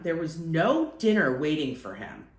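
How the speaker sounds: ordinary delivery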